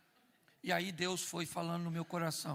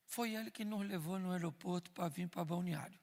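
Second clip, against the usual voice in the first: ordinary delivery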